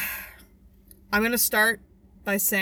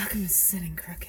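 breathy whisper, much softer; soft spa whisper; 4 semitones lower, 8 dB quieter than usual. Pretend. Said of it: "Oh, breathy?".

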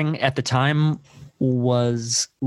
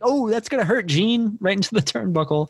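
much higher, goofy play-by-play imitation; mock fight commentary; 9 semitones higher than usual. Movie brawler narrating his own fight, goofy mock commentary. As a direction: call the hit like a hammy ringside commentator.

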